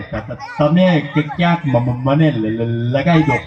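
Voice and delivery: imitating a stammering neighbour asking for work; stammering man. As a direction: stutter on each first sound. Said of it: "જેને જીભ ચોટતી હશે".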